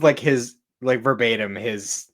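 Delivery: usual speaking voice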